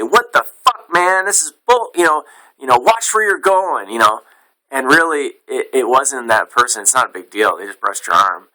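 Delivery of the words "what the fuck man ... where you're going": high mock yell at a stranger; angry outburst. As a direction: snap at the guy who bumped you, pitched way up.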